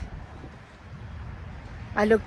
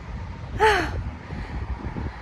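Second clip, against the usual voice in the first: a breathy gasp of wonder; soft awed gasp; 7 semitones higher, 8 dB quieter than usual. Real, not acted. Vlogger gasps at the lake view, soft and breathy. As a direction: catch your breath at the view.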